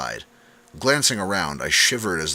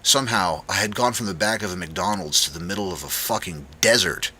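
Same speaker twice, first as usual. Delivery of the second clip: ordinary delivery, nothing marked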